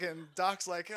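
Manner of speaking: ordinary delivery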